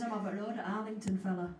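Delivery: understated Belfast voice for the friend; Belfast accent. Retold or imitated. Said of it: "that's a Belfast accent".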